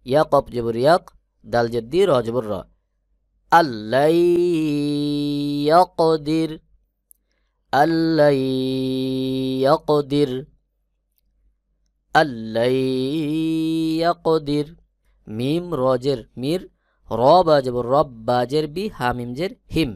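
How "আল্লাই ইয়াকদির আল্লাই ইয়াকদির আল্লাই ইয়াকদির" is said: "আল্লাই" merged straight into a doubled l, no nasal hum; joined without ghunnah. Shown as a demonstration. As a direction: recite "আল্লাই ইয়াকদির" with a clean l and no nasalisation. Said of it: "গুন্না ছাড়া মিলাতে হবে". ("আল্লাই" গুন্না ছাড়া মিলিয়ে পড়া)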